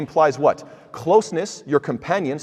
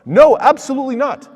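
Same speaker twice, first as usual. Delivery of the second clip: forceful denial; 11 semitones higher, 9 dB louder than usual. Real, not acted. Emphatic refutation of accusers.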